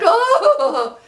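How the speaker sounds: a sudden high loud mock sob; mock bawling wail. Laughing at herself, a mock bawl. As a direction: ham up a wailing cry. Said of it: "it's not like I was bawling".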